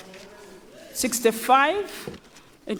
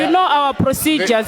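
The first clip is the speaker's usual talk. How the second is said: shouting order order; 5 semitones higher than usual. Real, not acted. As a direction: raise your voice to repeat it. Speaker repeats rebuke louder.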